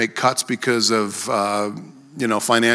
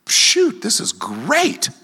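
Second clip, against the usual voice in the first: high burst then slow, drawn-out delight; mock delight; 10 semitones higher, much slower than usual. Preacher retelling his thrilled reply over lunch. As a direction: pop up high then savour it slowly. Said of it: "I'm like".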